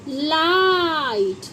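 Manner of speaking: लाइट stretched slowly, long ai; drawn-out demo word. Shown as a demonstration. Teacher to pupils, slow drawn-out demo. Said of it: "long vowel sound".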